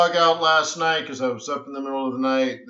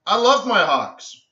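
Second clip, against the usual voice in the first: enthusiastic declaration; 7 semitones higher, 6 dB louder than usual. Retold or imitated. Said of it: "so I'm like".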